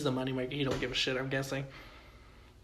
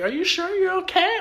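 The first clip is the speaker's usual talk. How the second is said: high, concerned crowd voice; worried bystanders; 9 dB louder than usual. Retold or imitated. Bystanders ask Tony after the shots, high and worried. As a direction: ask it pitched way up, anxious. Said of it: "everyone's like".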